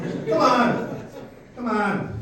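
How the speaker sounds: usual speaking voice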